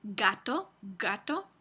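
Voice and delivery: gato with a stopped g; gato, g fully closed at the start; a firm stop g in gato; hard g. Shown as a demonstration. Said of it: "principio de palabra".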